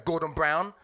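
ordinary talk, nothing marked

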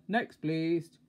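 loud, brusque shopkeeper call; big dismissive shout; bellowed call. A narrator's reading. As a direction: bellow for the next customer. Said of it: "bellowed the greengrocer".

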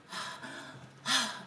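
deep breath for show, no voice; demonstrated deep breath. Shown as a demonstration. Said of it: "blow it out hard like that".

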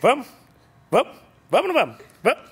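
eager urging. Real, not acted. Urging the dog and Stephanie.

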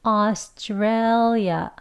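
Australia stretched syllable by syllable; Australia drawn out slowly; slow model of Australia; very slow demo. Shown as a demonstration. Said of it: "앞에부터 연결을 하면".

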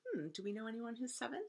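ordinary delivery

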